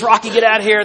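a mild mock of the trainer kicking Rocky out; Mickey again, trying the accent. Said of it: "But he says".

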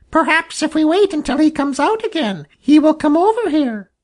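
a hopeful jay suggesting a plan; Sammy Jay voice. Acted. Jay suggesting to the crow they wait, high bird voice. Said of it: "said Sammy Jay".

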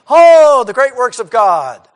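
loud, high gushing "Oh, the great works of God"; mock amazed crowd. Preacher mocking the fickle crowd, loud gushing awe. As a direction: ham up the crowd's wonder.

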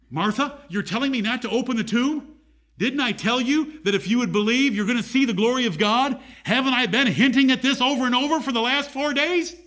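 high, incredulous run of questions; exasperated chiding. Acted. Preacher voices Jesus reminding Martha of what he said, exasperated.

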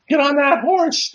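very high, squeaky holler; squeaky old ladies. Retold or imitated. Old ladies egging on the birthday man, squeaky holler. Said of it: "their squeaky voices".

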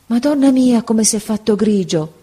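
ordinary delivery, nothing marked

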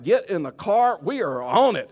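loud, keen go-ahead; eager agreement. Retold or imitated. Husband jumping at a dinner invitation, eager and loud. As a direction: punch it out, raring to go. Acts out eager reply to wife.

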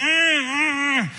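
loud, very high eager squeals; imitated straining grunts. Obnoxious kid begging to be picked, imitated squeal. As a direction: squeal loudly like a kid desperate to answer.

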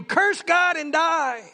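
high, loud, drawn-out cry; shouting. Acted. Job's wife shouts at you, a high slow shout. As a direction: shout it out slowly, pitched high. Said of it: "Job's wife shouts at you".

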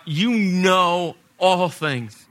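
slow, drawn-out "You know all things"; insistent, spaced-out declaration; emphatic plea. Acted. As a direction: stretch "know" and "all" with full weight. Peter's plea builds to climax.

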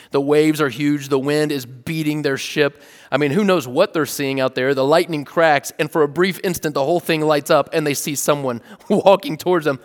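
ordinary talk

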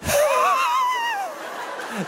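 high, wheezing laugh that trails away; long airy wheeze of laughter; smoker's laugh. Acted. Dad scoffing at doctor's advice, wheezy smoker's laugh. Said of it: "Smoker's laugh".

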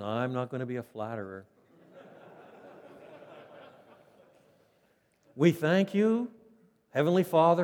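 a hint of a self-righteous cleric saying grace; mock stern pastor. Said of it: "other pastors in another tradition where they think".

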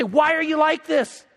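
retold angry dad, pitch climbing in frustration; exasperated scolding. Frustrated father wanting to scold his son, exasperated retold rebuke.

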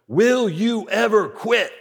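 slow, deliberate mock yell; retold coach's yell. Coach's last question to team, slow mock yell. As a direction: imitate a coach demanding an answer, word by word. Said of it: "Coach Boone yells one last question".

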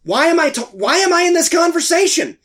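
high-pitched, incredulous retold protest; exasperated inner outburst. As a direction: act out a silent plea to escape.

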